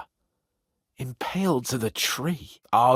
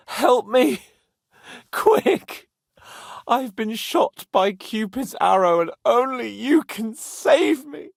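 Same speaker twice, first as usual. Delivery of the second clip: mock distress call; 8 semitones higher, 3 dB louder, slower than usual. Mock plea for rescue, lovesick.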